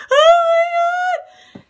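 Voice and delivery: high, loud drawn-out mock scream; Nick's panicked reaction. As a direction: shriek it slowly, imitating sheer terror. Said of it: "straight up with Nick's reaction".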